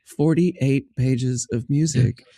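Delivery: measured out word by word; retold deadpan incredulity, drawn out; slow, stunned disbelief. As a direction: spell it out slowly in disbelief. Retold stunned reply after audition.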